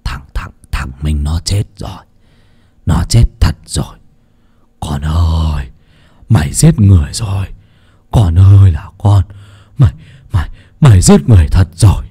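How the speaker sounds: low, shaken stammer; stammering in fear. A narrator's reading. Old man tells his accomplice the boy is dead: low terrified stammer. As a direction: stutter it out, horrified at the dead boy. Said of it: "mà lắp bắp".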